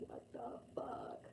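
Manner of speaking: slow breathy whisper of disbelief; reacting to the news in a slow shocked whisper; stunned whisper. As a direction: whisper it slowly, dumbfounded. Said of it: "you're gonna be like".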